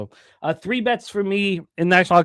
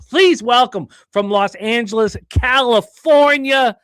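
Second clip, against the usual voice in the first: loud, high, drawn-out guest intro; big hype welcome, stretching the names; announcer introduction; 7 semitones higher, 6 dB louder, much slower than usual. Acted. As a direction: belt it out like a ring announcer.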